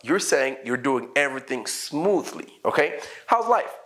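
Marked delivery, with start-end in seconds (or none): 3.3-3.7 bright greeting pitched high; acted small-talk question. Shown as a demonstration. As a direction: toss out a casual higher-pitched check-in. Acts out small-talk exchange.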